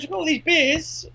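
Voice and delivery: high, eager voice with stress on these; keen salesman pitch; imitating the deli bloke. Retelling the deli owner repeating his beer boast, high and eager. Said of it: "He goes".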